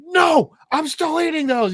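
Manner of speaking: high, loud mock outburst; pissed-off dad. Dad refusing to give up his Fritos, loud mock anger. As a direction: holler it as an irritated dad. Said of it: "the dad's pissed off".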